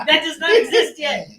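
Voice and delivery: loud and high, still laughing. Real, not acted. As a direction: keep shouting through laughs.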